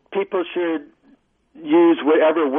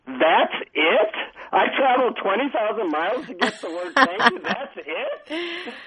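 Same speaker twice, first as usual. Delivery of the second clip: rising disbelief, voice climbing high; mock outrage at a tiny answer; incredulous; 8 semitones higher than usual. Narrator's incredulous reaction.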